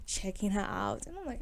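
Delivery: normal talking voice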